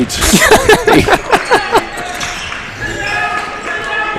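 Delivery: high, wheezing laughter; helpless wheezy giggle that keeps going; long laugh. Real, not acted. Amused by the botched play.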